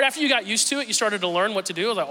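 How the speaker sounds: ordinary delivery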